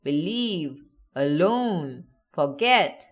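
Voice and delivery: "Believe, alone, forget" each weighted on the last syllable; light start, strong finish on "Believe, alone, forget"; stress on second syllable. Shown as a demonstration. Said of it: "stress on the second syllable".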